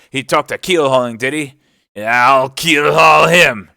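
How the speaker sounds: high, loud outburst building to a roar; enraged sailor's threat, shouted; excited, jerked-out phrases. A narrator's reading. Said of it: "he was jerking out these phrases".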